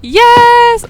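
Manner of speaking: excited cheer. Real, not acted. Finds the right block.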